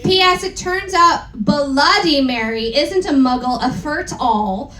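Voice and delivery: ordinary delivery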